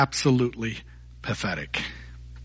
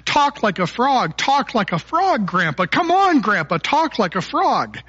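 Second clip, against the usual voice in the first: put-on higher-pitched, relentless kid begging; pestering little boy; 7 semitones higher than usual. Little boy nagging his grandpa to talk like a frog, pestering. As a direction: nag insistently like an impatient grandchild. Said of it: "he keeps asking him over and over again".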